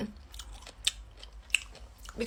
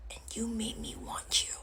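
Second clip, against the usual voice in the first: barely voiced, wounded accusation; hurt whisper; 17 dB quieter than usual. Woman tells ex he won her over, hurt whisper.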